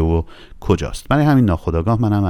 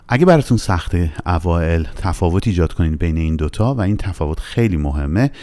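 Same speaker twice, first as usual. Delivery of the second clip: normal talking voice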